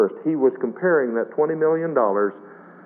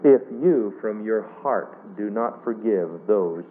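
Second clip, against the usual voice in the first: scripture reading; 4 semitones lower, slower than usual. Acted. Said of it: "reads the verse".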